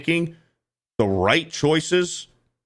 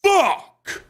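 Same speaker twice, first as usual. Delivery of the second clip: exasperated. Real, not acted. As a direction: spit it out, fed up. Reaction to her phrase.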